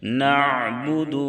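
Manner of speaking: نعبد with the ain sound flowing on, not cut; slow نعبد with a continuous ain; tajweed demo. Shown as a demonstration. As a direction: stretch the ain in نعبد smoothly. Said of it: "عین کی ادائیگی میں آواز".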